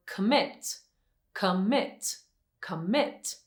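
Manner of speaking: "commit" with the second syllable stressed, rising. Shown as a demonstration. Teacher showing students where the stress falls, "commit" stressed on its second syllable. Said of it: "stress is in that second syllable".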